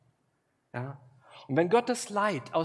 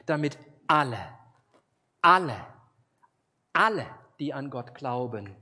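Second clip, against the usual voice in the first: each alle louder, the second much louder; building emphasis; 8 dB louder than usual. Acted. Preacher stressing that God's love is for everyone, each alle louder. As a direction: hammer home alle, alle, alle. Insistent repetition in quoted verse.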